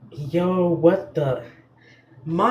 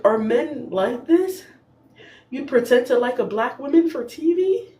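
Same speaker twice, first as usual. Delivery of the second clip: ordinary talk, nothing marked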